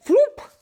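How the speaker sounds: popping floop sound; a loud high pop as he comes free. A narrator's reading. Sound of pulling him out.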